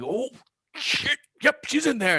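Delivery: very high, loud imp yelp; startled imp. Acted. Imp spotting the child in the crate, shrill alarm. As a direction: squeal it like a panicked little imp.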